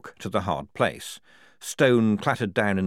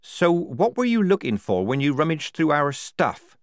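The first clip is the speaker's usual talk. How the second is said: higher, louder accusing question; confronting; 9 semitones higher, 5 dB louder than usual. Acted. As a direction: demand an answer accusingly. Polly confronts Strappy.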